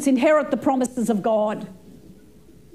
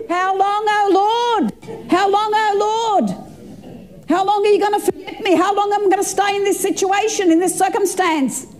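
pleading lament; 6 semitones higher than usual. Acted. Voicing the psalmist's lament.

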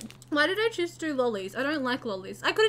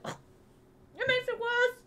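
sour grimace; 8 semitones higher, 6 dB quieter, much slower than usual. Real, not acted. Reacting to sour candy.

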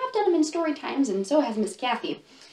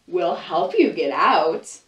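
crocodile voice; 5 semitones lower, 4 dB louder, slower than usual. Acted. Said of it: "One crocodile says".

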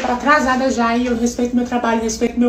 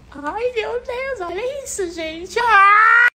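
amazed squeal; 10 semitones higher, much slower than usual. Real, not acted. Amazed at the house.

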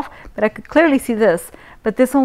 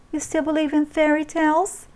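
higher mocking question; 7 semitones higher than usual. A narrator's reading. Mother taunting the intruder, higher mocking tone. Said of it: "She laughed".

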